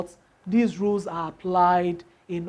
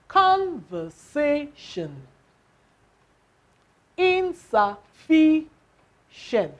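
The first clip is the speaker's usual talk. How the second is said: Conversation and Insufficient said slowly and higher; slow model words; 5 semitones higher, much slower than usual. Shown as a demonstration. Teacher models four-syllable words slowly for students. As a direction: stretch each word so the stress shows. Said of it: "the stress is on the what".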